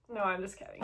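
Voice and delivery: usual speaking voice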